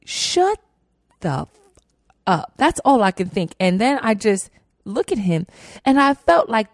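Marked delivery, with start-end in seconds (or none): 0.0-2.5 inner angry retort. Retold or imitated. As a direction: bite the words out in your head. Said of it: "And I'm like".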